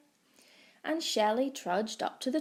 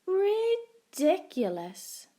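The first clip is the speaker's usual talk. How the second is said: drawling cat; 8 semitones higher, 4 dB louder, much slower than usual. A narrator's reading. Cat mocking.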